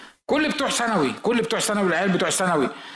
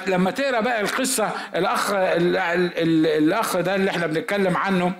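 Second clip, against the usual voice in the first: ordinary talk, nothing marked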